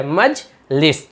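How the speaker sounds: "मजलिस" slowly pulled apart as मज ... लिस; divided into syllables. Shown as a demonstration. Teacher dividing the word for learners, slow split "Majlis". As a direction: read "Majlis" in two parts with a gap. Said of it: "divide कर कर के पढ़े".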